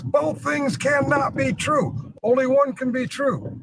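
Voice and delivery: high, slow, every word pressed; emphatic insistence. Real, not acted. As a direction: spell it out slowly, hammering the point. Insisting during heated argument.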